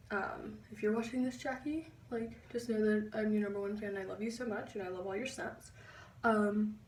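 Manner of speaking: ordinary talk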